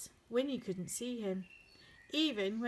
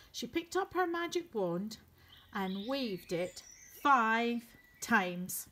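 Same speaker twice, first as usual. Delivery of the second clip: plain reading voice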